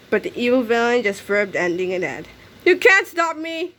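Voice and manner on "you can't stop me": 1 yelled. A narrator's reading. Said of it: "he yelled".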